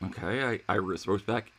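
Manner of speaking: normal talking voice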